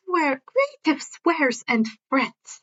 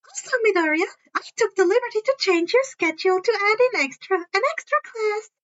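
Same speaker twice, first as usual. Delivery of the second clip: high, chirpy principal voice with a sly edge; Principal Netsu, high and chirpy; 9 semitones higher, faster than usual. A narrator's reading. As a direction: pitch it up like a cheerful little animal principal.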